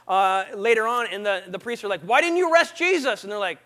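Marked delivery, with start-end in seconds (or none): 2.0-3.2 higher, louder acted reproach; indignant demand. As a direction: confront the guards as an affronted priest. Priests confront the guards, acted.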